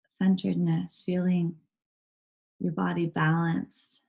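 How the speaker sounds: calm, even meditation guide with long pauses; unhurried, steady voice guiding a body scan; guided meditation. Real, not acted. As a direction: lead them gently into stillness, leaving space between phrases.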